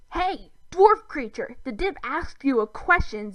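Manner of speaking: indignant alien rebuke; hissing demand. A narrator's reading. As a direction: demand an answer impatiently. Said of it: "Zim hissed".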